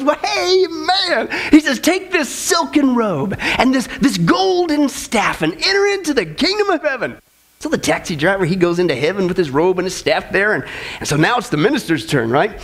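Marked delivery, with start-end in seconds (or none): 0.0-7.2 Saint Peter. Acted. Saint Peter welcomes the taxi driver.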